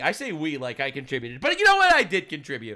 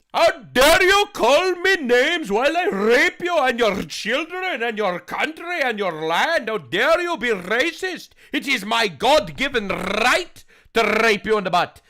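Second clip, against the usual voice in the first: mock thick Somali accent, higher, louder and slower; hammed-up Somali accent, pitched up and pushed loud; 7 semitones higher, 7 dB louder than usual. Mocking imagined voices in a thick Somali accent. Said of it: "in the thick Somali accent".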